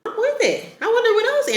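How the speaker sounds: very high, excited approval; delighted squeal. Real, not acted. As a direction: squeal it out happily. Delighted reaction to narrator.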